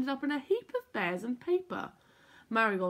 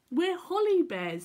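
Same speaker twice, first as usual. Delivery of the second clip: proudly; 8 semitones higher, 4 dB louder than usual. A narrator's reading. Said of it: "said Rosie proudly".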